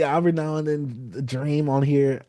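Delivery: ordinary delivery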